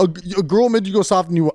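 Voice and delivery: usual speaking voice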